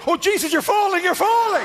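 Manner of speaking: brain screaming you're falling in high panic; panicked inner voice. Pretend. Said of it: "half your brain's going".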